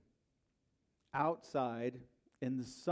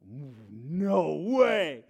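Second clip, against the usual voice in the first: indignant; 11 dB louder than usual. Retold or imitated. Driver flees, he chases.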